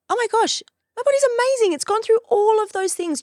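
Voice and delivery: joyful rave about her body; gushing joy. Real, not acted. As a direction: burst out in delight. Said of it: "gushing about her body".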